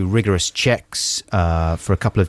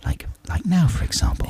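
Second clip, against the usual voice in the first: soft, breathy demo of a whisper; 7 semitones higher, 3 dB quieter than usual. Shown as a demonstration. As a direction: drop to a near whisper. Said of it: "get down to a whisper".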